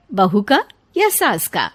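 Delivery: usual speaking voice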